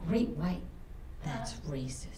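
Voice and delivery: hushed, low confiding voice; imitated whisper. Daughter whispering in mother's ear about the shark, hushed. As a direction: drop it low and soft, into someone's ear. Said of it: "She whispered in my ear".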